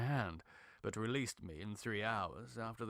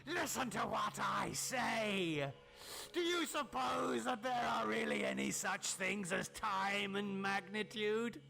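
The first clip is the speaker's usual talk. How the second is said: gloating, menacing madman, higher than the narrator; mad scientist; 8 semitones higher than usual. Acted. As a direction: taunt the trapped friend with cruel triumph. Tillinghast gloats and threatens.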